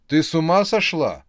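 loud, high startled yell; frightened shout. A narrator's reading. Vadim jumping back from the bed, yelling. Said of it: "с перепугу закричал он".

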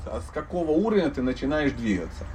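ordinary talk

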